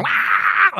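high-pitched mock wail; cartoonish captain's scream; imitated scream. Captain slapped by an orangutan, high mock scream.

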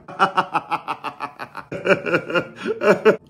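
rapid high staccato laugh in bursts; long machine-gun giggle, building at the end; stuttering giggle. Real, not acted. Tipsy host insisting he's fine, breaks into stuttering giggles.

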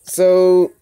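loud drawn-out so; loud call. Real, not acted. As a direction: call the group to attention. Calling the meeting to order.

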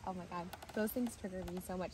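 usual speaking voice

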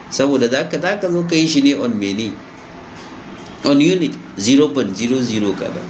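ordinary talk, nothing marked

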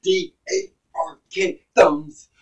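slow, drawn-out letters of the channel name; spelled-out catchphrase. Acted. Reactor spelling his channel name, drawn out slow. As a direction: spell it out like a signature intro.

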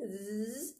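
slow buzzing like a fly. Shown as a demonstration. Teacher runs through the fly warm-up. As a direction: buzz through the teeth. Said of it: "make a zzz sound".

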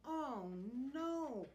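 high, loud wail of dismay; loud storybook alarm; dismayed cry. A narrator's reading. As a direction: cry out as if all is lost. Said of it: "cried Santa".

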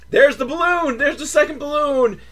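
high-pitched mock thrill; excited discovery. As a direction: call out the sighting eagerly. Acts out spotting the balloon.

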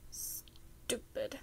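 whispered under the breath; muttered. A narrator's reading. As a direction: mutter it to yourself in frustration. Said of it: "he muttered to himself".

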